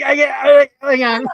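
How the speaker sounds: high, loud flustered outburst; mock panicked shout. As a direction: blurt it out in a fluster. Mock panicked quote, laughing.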